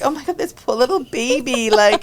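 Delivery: mock cooing. Coach imitating herself cooing over the new girl. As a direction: coo over a poor little stray. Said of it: "and I'm like".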